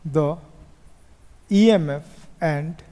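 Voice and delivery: word-by-word dictation with long pauses; measured, spaced-out classroom dictation; slow dictation. Real, not acted. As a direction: dictate slowly for note-takers.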